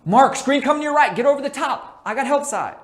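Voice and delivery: loud, high call starting with the name; court call. Shown as a demonstration. Coach calling to Mark on defense, loud and high. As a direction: shout it so Mark hears over the noise. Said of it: "remember names first".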